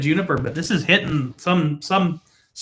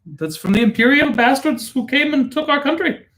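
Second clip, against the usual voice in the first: mock Indian accent; 7 semitones higher than usual. Accented joke about imperial Britain.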